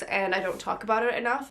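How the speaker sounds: ordinary delivery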